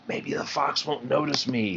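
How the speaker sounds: soft, high inward worry; hushed thought. Acted. Thumper hides from the fox, softly to himself. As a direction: keep it soft, hoping not to be seen. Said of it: "he thought".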